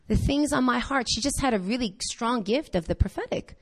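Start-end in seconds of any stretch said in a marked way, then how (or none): none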